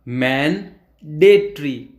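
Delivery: मैनडेटरी said very slowly, open mae then de; slowed demonstration. Shown as a demonstration. Teacher spelling out the sound of मैनडेटरी for students, very slow. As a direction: stretch मैनडेटरी out, syllable by syllable. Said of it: "तो आपका word हो जाएगा".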